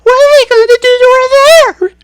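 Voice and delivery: very high, loud outraged mother; yelling. A narrator's reading. Mother panicking.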